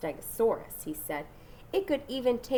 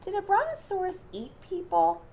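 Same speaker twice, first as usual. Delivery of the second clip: put-on nervous child's question, voice raised high; asked nervously; 11 semitones higher, 4 dB louder than usual. A narrator's reading. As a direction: ask it with a nervous edge. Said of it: "he asked nervously".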